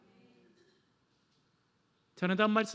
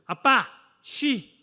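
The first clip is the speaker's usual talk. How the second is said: put-on loud, high child's call of "아빠! 아빠!"; a child calling dad at dawn; 5 semitones higher, 7 dB louder, much slower than usual. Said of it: "애들이 그래요". (새벽에 아빠를 부르는 아이, 크게 외치는 소리)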